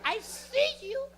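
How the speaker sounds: high, slow mock-mom voice; knowing, high-pitched immigrant mom; passive-aggressive mom. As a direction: stretch it out like a mother who has caught you. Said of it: "She's being passive aggressive".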